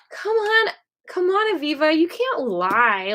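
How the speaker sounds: high-pitched retold scolding; coaxing reproach. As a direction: push her in a high voice to admit it. Retold scolding of her lying self.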